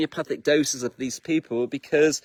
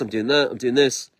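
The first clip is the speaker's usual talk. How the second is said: ordinary delivery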